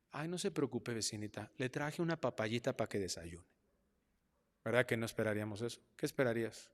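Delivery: normal talking voice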